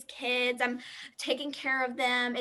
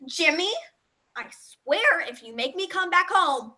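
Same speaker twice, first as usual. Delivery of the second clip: slow, louder warning down the phone; angry mom threat; 3 semitones higher, 4 dB louder, slower than usual. Acted. Mom at a party threatens Jimmy over the phone, slow and louder. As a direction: threaten the kid, word by word.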